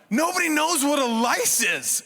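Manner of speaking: ordinary talk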